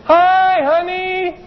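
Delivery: high, bright "Hi, honey" like a floating cloud-sitter; mock airy greeting. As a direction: chirp it up high and light.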